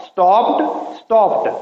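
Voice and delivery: स्टॉप्ड with a final t sound, stopt. Shown as a demonstration. Teacher modelling the t ending of स्टॉप्ड for the class. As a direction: finish स्टॉप्ड on a crisp t. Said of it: "वो बनी है टी की आवाज़".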